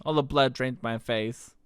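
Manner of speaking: ordinary delivery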